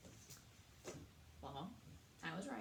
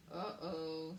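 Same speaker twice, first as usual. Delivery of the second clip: rat's uh-oh; 5 semitones lower than usual. A narrator's reading. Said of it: "said Roscuro".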